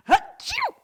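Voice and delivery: very high, loud fake sneeze; sneezing. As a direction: blast out a huge mock achoo. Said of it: "I have to sneeze".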